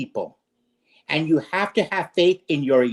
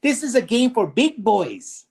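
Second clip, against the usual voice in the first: higher, slow, sneering call to the critics; mocking taunt; 5 semitones higher than usual. Host taunting impatient critics with a slow mocking jeer. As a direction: drawl it out like a taunt.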